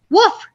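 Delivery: high, loud playful bark; dog woof. Acted. Reader playing the dog, loud playful woof. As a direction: bark it out for the kids. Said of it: "said the dog".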